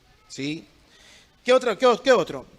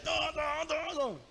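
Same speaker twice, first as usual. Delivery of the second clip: high, held-back mock rage; imitated blind anger; 9 semitones higher, 7 dB quieter, much slower than usual. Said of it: "Porque ya la ira".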